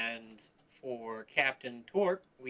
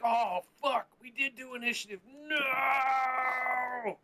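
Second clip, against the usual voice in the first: dismayed cry; 11 semitones higher, much slower than usual. Retold or imitated. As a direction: wail at your own mistake. Realizing initiative already rolled.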